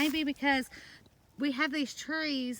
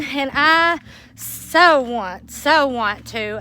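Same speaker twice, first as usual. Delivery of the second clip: "so" drawn out long and slow; stress on so; 2 semitones higher, 11 dB louder, much slower than usual. Real, not acted. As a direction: linger on "so want" with longing. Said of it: "I'm so excited".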